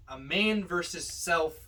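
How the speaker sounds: ordinary delivery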